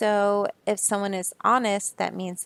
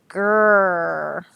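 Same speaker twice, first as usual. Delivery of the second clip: drawn-out growl; growled name; 7 dB louder than usual. Pretend. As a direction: growl it like a grumpy dog. Growly name of mean neighbor.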